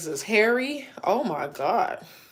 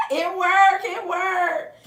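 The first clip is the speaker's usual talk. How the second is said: squealing with delight, laughing; real thrilled laughter; excited; 9 semitones higher, slower than usual. Real, not acted. Thrilled wax works.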